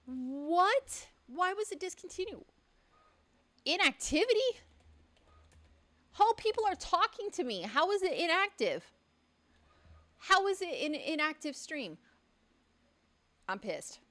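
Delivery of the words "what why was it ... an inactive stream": high, indignant questions; pitched-up exasperation at the app; outraged disbelief. Real, not acted. Streamer venting at TikTok, high and indignant.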